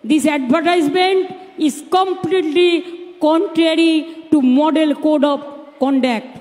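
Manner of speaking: slow, deliberate reading of the lawyer's words; quoting the lawyer. Retold or imitated. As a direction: spell out each word of the quote slowly. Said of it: "একজন lawyer reply করেছে".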